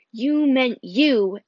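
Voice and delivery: "You meant you" slow, leaning hard on both "you"; stress on you. Acted. Boy pressing his friend, stressing the word you. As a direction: press the second "you" to pin him down. Percy insists Grover meant him.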